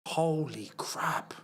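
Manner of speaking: slow whispered shock; whispered disbelief; stunned whisper. Real, not acted. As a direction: breathe it out in a whisper, slowly. Stunned reaction to leaked picture.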